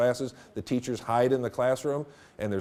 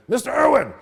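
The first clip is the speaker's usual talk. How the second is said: loud, higher call of a name; sensei calling out; 12 semitones higher, 8 dB louder than usual. Retold or imitated. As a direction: bark the student's name like a karate teacher. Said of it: "he said".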